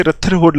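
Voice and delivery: "रथरफोर्ड" with aspirated th as written; mispronounced name. Shown as a demonstration. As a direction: show the misreading "रथरफोर्ड", th instead of d. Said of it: "लेकिन रथरफोर्ड नहीं है".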